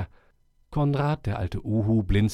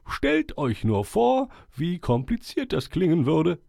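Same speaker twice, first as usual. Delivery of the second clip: put-on higher, solemn old owl voice; 6 semitones higher than usual. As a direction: speak like a wise old owl addressing the forest. Owl speaking again.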